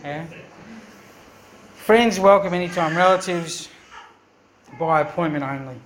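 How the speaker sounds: usual speaking voice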